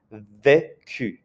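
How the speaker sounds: "vécu" with a hard K and an "ay" first vowel. Shown as a demonstration. Teacher contrasting it with reçu, "vécu" with a hard K. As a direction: say "vécu" as vay-KU, French u at the end. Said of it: "It's a hard K sound".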